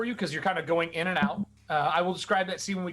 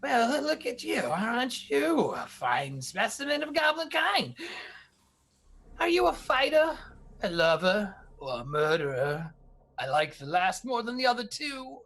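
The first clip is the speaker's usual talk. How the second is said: sly slave buyer sizing up a goblin; Slish's voice; 3 semitones higher than usual. Acted. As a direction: size up the goblin like merchandise. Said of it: "Dominus Slish, he goes".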